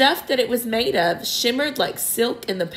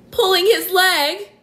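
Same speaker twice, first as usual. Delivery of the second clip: high, louder gleeful cry; cried with glee; 11 semitones higher, 5 dB louder, slower than usual. A narrator's reading. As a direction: delight in the joke. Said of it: "he cried".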